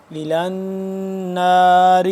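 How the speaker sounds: slow melodic Quranic chant; Quran recitation. Shown as a demonstration. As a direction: recite it like tilawat with long vowels. Recites verse. (قرآن کی تلاوت کا انداز)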